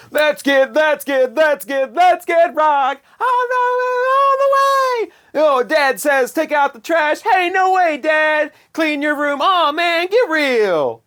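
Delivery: high, hammed-up Disney kid rocker; mock kids' rock band. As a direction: chant it like a pretend rebellious child star. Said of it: "like the Disney Kids or like the Kids Incorporated".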